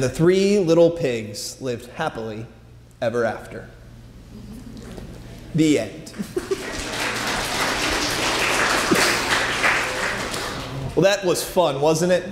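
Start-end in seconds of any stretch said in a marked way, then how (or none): 6.2-10.9 quiet breathy chuckle; amused breath laugh; soft laugh. Real, not acted. After story ends.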